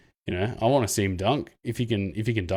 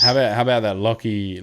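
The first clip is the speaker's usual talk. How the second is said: ordinary talk, nothing marked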